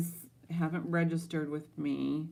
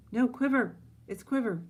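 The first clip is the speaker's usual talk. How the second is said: higher, louder realization; sudden correction; 7 semitones higher, 6 dB louder than usual. Real, not acted. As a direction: catch your mistake out loud. Correcting herself loudly.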